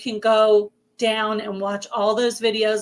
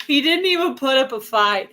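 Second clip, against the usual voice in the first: laughing; 5 semitones higher, 4 dB louder than usual. Real, not acted. Laughing at husband's easy agreement.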